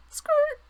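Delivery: very high, loud skid; skid sound effect. Pretend. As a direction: squeal it like skates braking. Voicing a comic sound effect.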